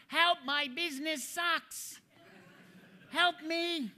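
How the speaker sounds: high, slow pleading whine, hammed up; mock Andrew Locke plea. Speaker mimics a colleague's book title as a high plea. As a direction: beg for help in a silly high voice. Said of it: "You got Andrew Locke".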